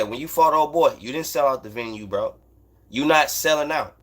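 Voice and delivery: normal talking voice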